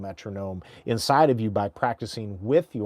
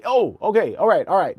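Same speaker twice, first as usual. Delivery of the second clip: impressed crowd; 7 semitones higher, 5 dB louder than usual. Retold or imitated. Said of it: "when they say".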